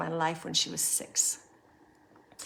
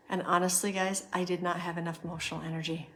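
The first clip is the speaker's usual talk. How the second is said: ordinary delivery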